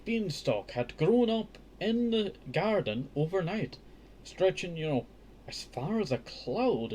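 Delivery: slow, lifted read-aloud voice; careful children's book narration; storybook reading. A narrator's reading. Resumes reading the tale.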